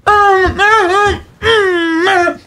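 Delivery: high, strained moaning; high-pitched wordless straining; imitated seizure moans. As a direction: mimic a fit of overexcited groans. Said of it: "Like a seizure?".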